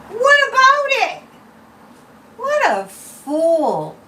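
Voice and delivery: high, loud sneering mimic; mock the reporter with scorn; high scornful mockery; mocking impression. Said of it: "that idiot Peter Doocy".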